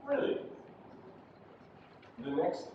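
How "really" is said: "Really" pitched high, then falling; falling intonation. Shown as a demonstration. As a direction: start "Really" high and let it drop. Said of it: "Yeah, that's falling intonation".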